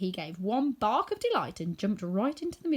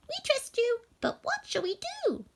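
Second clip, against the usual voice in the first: much higher, gracious little voice; squeaky mouse queen. A narrator's reading. Mouse queen praising the woodman, high squeak. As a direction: thank him royally, squeaking.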